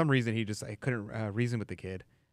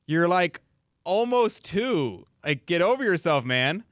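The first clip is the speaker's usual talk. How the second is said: mock exasperated, louder and higher; 7 semitones higher, 7 dB louder than usual. Telling a crying two-year-old to get over himself, mock exasperated. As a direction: scold the toddler in playful disbelief. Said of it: "And I'm like".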